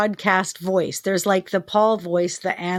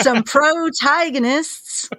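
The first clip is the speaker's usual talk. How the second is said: put-on slow, higher old prospector drawl; 6 semitones higher, much slower than usual. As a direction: drag out protagonists like an old miner. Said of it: "You just sound like an old prospector".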